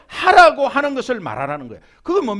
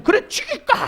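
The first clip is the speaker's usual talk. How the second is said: high, mock-threatening husband; husband's angry retort. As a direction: snap back at the wife. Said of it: "하면서 막 구당당당". (아내에게 맞받아치는 남편 흉내)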